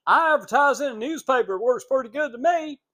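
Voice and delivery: fast and high, a lightly voiced business owner; shop owner voice. Shop owner answering a radio salesman, lightly voiced.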